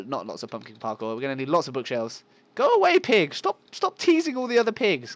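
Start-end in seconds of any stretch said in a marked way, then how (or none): 2.6-5.2 high, loud shooing; exasperated mock telling-off; playful scolding. Player shooing a pig from teasing others, high loud scolding.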